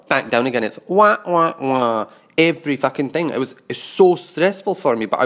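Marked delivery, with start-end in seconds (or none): 0.9-2.1 high loud whining wah; mock wah wah wah. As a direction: whine it like a sulky child. Mocking boyfriend's reaction.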